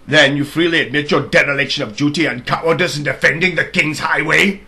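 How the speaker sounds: loud, stern royal accusation; accusing king. Acted. King accuses the soldier of cowardice, loud and stern. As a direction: thunder the charge of cowardice.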